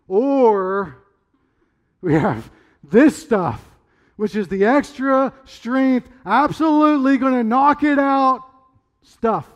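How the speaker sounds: loud, high, slow hammed-up sales pitch; shady drug dealer. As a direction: tempt them like a dodgy dealer pushing the good stuff. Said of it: "She's like a little drug dealer".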